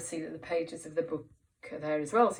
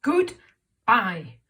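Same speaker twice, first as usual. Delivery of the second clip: cross voice; 9 dB louder, much slower than usual. Shown as a demonstration. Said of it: "she says this in a cross voice".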